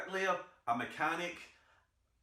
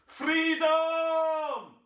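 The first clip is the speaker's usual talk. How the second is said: much higher, much louder war cry; Wallace battle cry; 14 semitones higher, 9 dB louder than usual. Retold or imitated. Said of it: "William Wallace didn't shout".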